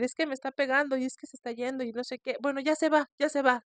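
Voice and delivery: high, fast frightened babble; scared voice. Retold or imitated. Caller reporting the spirit to the host, high frightened rush. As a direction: rush it out, terrified. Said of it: "Claramente con su voz así".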